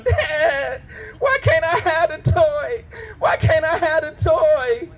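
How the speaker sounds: slow high mock whine; whining daughter. Little daughter begging dad for a toy, mock whine. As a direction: whimper like a kid denied a toy. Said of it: "My daughter will fall out on me".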